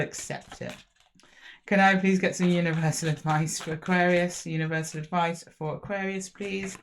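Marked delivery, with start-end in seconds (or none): none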